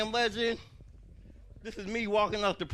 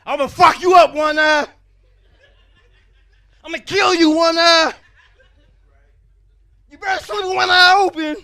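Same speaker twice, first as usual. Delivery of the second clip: shouted, higher threats; hammed-up threatening yell; mock heckler scream; 7 semitones higher, 14 dB louder than usual. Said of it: "scream wild shit out at me".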